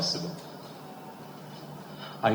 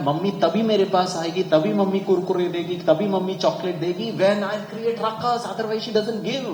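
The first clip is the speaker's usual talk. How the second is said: put-on quick, higher voice of a scheming little child; child's voice; 7 semitones higher, 5 dB louder, much faster than usual. A child explaining how to get mummy's attention, quick and high. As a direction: rattle it off like a kid who knows the trick. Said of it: "बच्चा को पता है".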